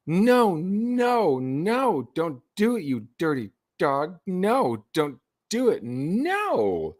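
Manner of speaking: high teasing sing-song "no, don't do it"; playful flirty no.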